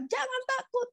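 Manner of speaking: very high acted call; high-pitched, thin-volume holler. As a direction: call reassurance from far away. Acts Jesus calling to disciples.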